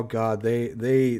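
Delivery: ordinary delivery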